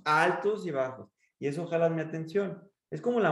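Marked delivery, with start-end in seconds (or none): 0.0-1.1 "altos" lifted and stretched, "bajos" dropped; highs and lows. Shown as a demonstration. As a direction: swing up on "altos", fall on "bajos". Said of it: "van dándome altos y bajos".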